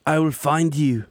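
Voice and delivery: mock movie-hero botched British accent. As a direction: deliver a badly attempted British accent. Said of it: "when Costner does an accent and botches it".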